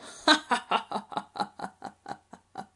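long loud ha-ha-ha trailing off; big slow villainous chuckle; crocodile laugh. Acted. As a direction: laugh until out of breath. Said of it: "sonrió Cocodrilo".

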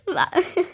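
quick giggle; a little burst of laughter; short laugh. Real, not acted. Laughs at the clip.

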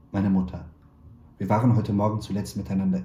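ordinary delivery

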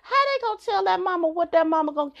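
put-on baffled at bossy kids; incredulous thought. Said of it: "you thinking to myself".

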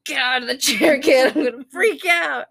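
strained, half crying plea; choked panic, get out of the chair; tearful panic. Retold or imitated. Anxious mom to her son, half crying. Retold panic in clinic room.

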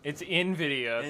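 slow "Nvidia" starting with "in"; pronunciation correction. Shown as a demonstration. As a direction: say "Nvidia" as in-VID-ee-uh, drawn out. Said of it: "adding an extra, like, syllable to it".

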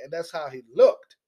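ordinary talk

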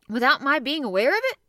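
Ned can't believe it, voice shooting up; amazed disbelief. A narrator's reading. Ned astonished at pressure.

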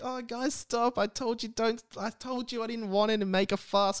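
high, play-embarrassed pleading; mock bashful protest. Acted bashful protest to birthday cheer.

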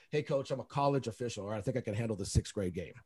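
low, soft retold put-down; quiet, offhand imitation of the referee; over-the-shoulder remark. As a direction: toss it casually over the shoulder. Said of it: "like, just over his shoulder like that".

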